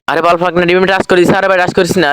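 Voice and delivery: high angry yell at teammate; frustrated yell. Real, not acted. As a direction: snap at a reckless teammate. Yelling at rushing teammate. (রাগে উঁচু গলায় চিৎকার)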